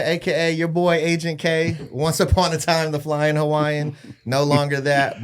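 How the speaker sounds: normal talking voice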